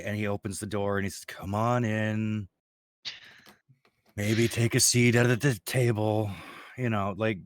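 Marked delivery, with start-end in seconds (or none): 1.2-6.3 slow, resigned invitation; dejected. Acted. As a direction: say it with a defeated sigh. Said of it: "there's a dejected sigh".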